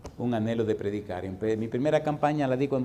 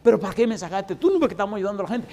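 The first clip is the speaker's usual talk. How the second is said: higher, louder, rushing complaint; a hammed-up outburst at being pulled out; protesting cry; 7 semitones higher, 6 dB louder, faster than usual. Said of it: "empecé una gritería y una lloradera".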